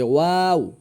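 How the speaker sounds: "ওয়াও" with its vowel drawn long; long, sustained "ওয়াও"; stretched letter name. Shown as a demonstration. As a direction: hold the middle of "ওয়াও". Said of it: "আমি হরফে টান দিচ্ছি".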